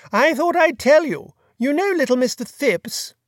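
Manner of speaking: put-on chatty, high-pitched grand old lady; imperious plummy grande dame. A narrator's reading. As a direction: gossip like a fluttery duchess. Duchess gossips on phone.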